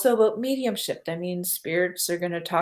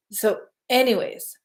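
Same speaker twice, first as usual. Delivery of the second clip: normal talking voice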